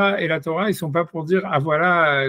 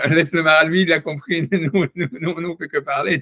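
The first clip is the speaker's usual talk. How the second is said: laughing quote. Retold or imitated. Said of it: "ils ont dit".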